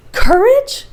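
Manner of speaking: a sharp high rising "Courage?"; stunned echo of the uncle's word; incredulous. Acted. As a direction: fling the word back in disbelief. Axel echoes in disbelief.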